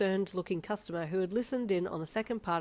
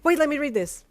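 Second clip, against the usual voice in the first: high loud urgent stop; urgent loud plea; 9 semitones higher, 12 dB louder than usual. Acted. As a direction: halt him, loud and high. Urgent stop before reading.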